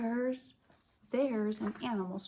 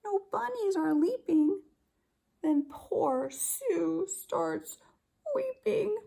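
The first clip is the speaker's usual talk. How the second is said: higher and slower, a touch of crying; mock weeping; 9 semitones higher, 3 dB louder than usual. Teacher acting out Sue's sorrow for her class. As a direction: let the voice break on weeping.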